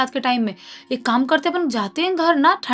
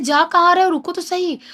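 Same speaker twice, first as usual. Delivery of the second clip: calling out; 6 semitones higher, 4 dB louder than usual. Acted. Wife calling after running husband.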